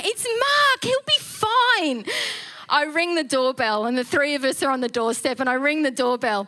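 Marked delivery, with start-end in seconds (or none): 0.0-2.0 high and slow, breezy reassurance; drawn out like it's obvious; telling the anxious ladies it's fine, breezily; confident reassurance. Retold or imitated.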